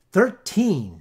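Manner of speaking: loud, booming count; the big bell. A narrator's reading. Big bell striking over the town, loud. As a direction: toll it out like a great bell. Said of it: "said he".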